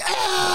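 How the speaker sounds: high, held "ah" yell; imitated roar of rowdy teammates; mock group cheer. Gym mates erupting at the new guy's yes, mock yell.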